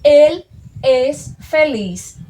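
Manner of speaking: "Él es feliz" dito bem devagar e mais forte; frase de exemplo lenta. Shown as a demonstration. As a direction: leia o exemplo devagar e com clareza. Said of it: "colocando esses dois em uma frase". (lendo a frase de exemplo devagar para os alunos)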